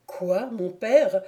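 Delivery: plain reading voice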